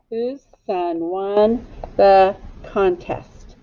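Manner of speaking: "Whose son won the contest?" read slowly and evenly; careful slow reread for children; slow model sentence. Shown as a demonstration. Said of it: "Echo me".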